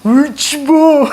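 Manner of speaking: high, drawn-out mock wail; mock tearful. Night guard starts crying while hushing recruits, mock wailing. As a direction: break into hammed-up crying mid-order. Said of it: "그래서 얘도 우는 거야".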